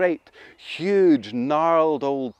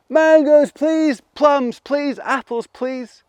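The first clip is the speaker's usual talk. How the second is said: high, loud pleading calls; loud high calls, last one slower; calling out; 11 semitones higher, 6 dB louder, much slower than usual. Acted. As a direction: shout your wish up to the tree. Children call fruit on three.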